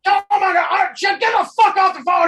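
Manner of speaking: loud, high screaming rage; hammed-up fake fury for the caller; furious shout. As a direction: scream threats at the top of your voice. Faked rage for telemarketer.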